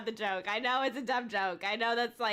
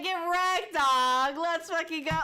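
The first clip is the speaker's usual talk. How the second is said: loud, raised gloating cheer; gloating hype; 5 semitones higher, 6 dB louder, slower than usual. Real, not acted. Streamer taunting over a failed unfollow, gloating hype. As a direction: crow it out loudly in triumph.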